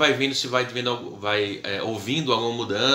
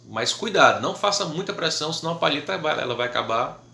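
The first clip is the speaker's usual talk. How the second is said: ordinary talk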